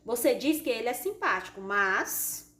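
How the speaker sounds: MAS drawn out and left hanging; left in suspense. Shown as a demonstration. Teacher showing a suspended sentence, suspenseful. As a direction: break off after a long MAS. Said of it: "deixar um assunto também suspenso".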